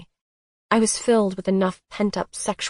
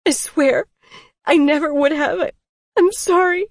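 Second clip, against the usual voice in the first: high, loud tearful sorry; crying apology; 10 semitones higher, 7 dB louder than usual. A narrator's reading. Crying her apology to Colton. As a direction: cry the apology, voice cracking. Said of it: "I cried".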